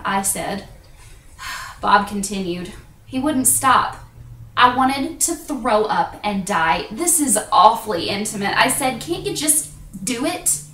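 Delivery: measured, confessional stage delivery with pauses; theatrical monologue. Acted. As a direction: tell it as a solo stage confession.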